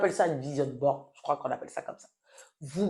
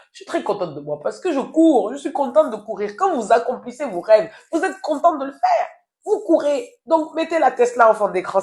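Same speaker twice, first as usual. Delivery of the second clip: excited; 8 semitones higher, 7 dB louder than usual. Real, not acted.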